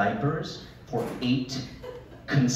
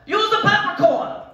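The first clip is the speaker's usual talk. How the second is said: high, loud scolding nonna; grandma scolding her grandson, high and loud; Grandma as Joe Pesci; 8 dB louder than usual. Retold or imitated. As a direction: snap at him like a tough old Italian. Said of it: "My grandmother was Joe Pesci".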